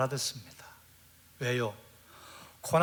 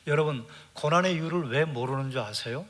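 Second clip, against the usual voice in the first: ordinary talk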